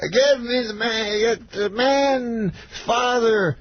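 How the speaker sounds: high, very slow grand order; stretched words, halting high impression; Bill Cosby. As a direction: imitate a star instructing his staff. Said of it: "And he goes".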